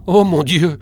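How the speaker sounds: loud, high startled cry; a boy's sudden cry at hanging swords; cry of alarm. A narrator's reading. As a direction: shout it out in shock. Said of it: "crie Henri".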